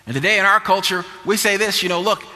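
high, pressing preacher's delivery; full-force preaching. Real, not acted. Preacher warns the church about today's push to compromise, full-force preaching. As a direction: drive it at the congregation in forceful bursts.

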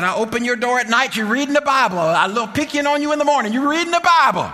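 high, building mock tirade; mama telling off. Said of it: "telling me off".

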